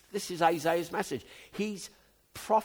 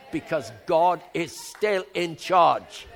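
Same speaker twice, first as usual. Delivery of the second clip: rising shout; 8 dB louder, slower than usual. Real, not acted. Elderly preacher declaring enthusiasm.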